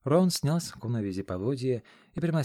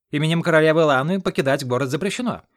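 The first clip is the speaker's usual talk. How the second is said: much louder official decree; loud proclamation; 5 semitones higher, 9 dB louder than usual. A narrator's reading. Weasel-like aide barring the gate, loud decree. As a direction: announce the ban with full force. Official forbids leaving the city.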